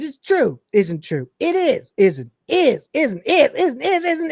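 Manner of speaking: high bratty back-and-forth; a hint of sulky children arguing; childish squabble. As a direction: bicker like two kids in a playground. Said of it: "just like a couple of fucking children".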